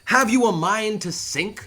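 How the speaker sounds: loud, high, slow exasperated yell; shouting at passengers. Acted. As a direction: yell it at the nobles in your way. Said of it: "So he's calling, uh, at these people".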